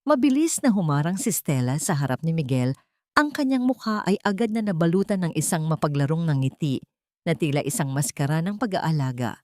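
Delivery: ordinary delivery, nothing marked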